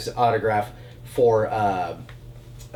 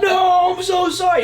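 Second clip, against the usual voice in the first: very high, loud frantic shout of sorry; panicked apology; 8 dB louder than usual. Retold or imitated. Actor freaking out apologizing to the boyfriend, shouted high. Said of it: "he freaked out".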